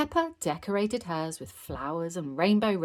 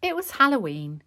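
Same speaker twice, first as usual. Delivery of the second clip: plain reading voice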